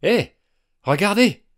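high, loud excited shout; high, loud startled cry of discovery; sudden exclamation. A narrator's reading. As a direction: call out to make them look. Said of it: "s'exclama soudain Max".

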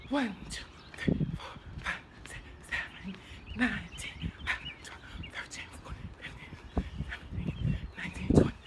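whispered rushed counting; breathless whisper. Real, not acted. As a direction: count under your breath.

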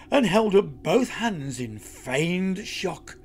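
ordinary delivery

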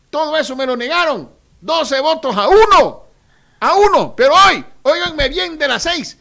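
high, loud rising oratory; fiery rally shout. Real, not acted. Candidate denouncing rival party, loud fiery shout. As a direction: hammer it home to the crowd.

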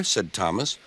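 usual speaking voice

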